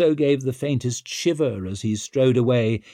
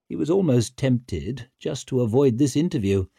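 plain reading voice

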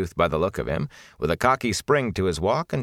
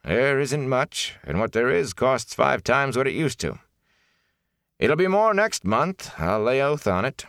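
high-pitched, resigned innkeeper; resigned innkeeper grumbling about costs; 6 semitones higher than usual. A narrator's reading. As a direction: lament it in a raised character voice. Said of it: "he said in a resigned voice".

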